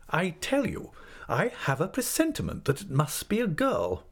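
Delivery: high, emphatic insistence; opinionated aunt overruling an objection; insistent aunt figure. Acted.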